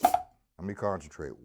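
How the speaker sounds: ordinary talk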